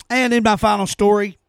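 usual speaking voice

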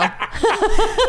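high burst of real laughter. Real, not acted. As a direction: crack up high. Laughs at teasing.

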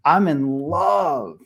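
put-on drawn-out, slow boyish declaration; lovestruck declaration. Son confessing to his dad in the hot tub, lovestruck. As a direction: stretch out the word love, smitten.

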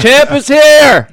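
loud, slow champ brag; frat bro. Real, not acted. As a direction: announce yourself like a champion. Bragging about the belt.

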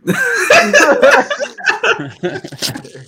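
loud high burst of laughter. Real, not acted. Laughing at own punishment story, loud high laugh. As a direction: let out a big laugh.

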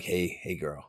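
low, soft mock crooner; sultry perfume ad. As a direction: imitate a smooth come-on. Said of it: "you're like".

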